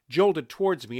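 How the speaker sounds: plain reading voice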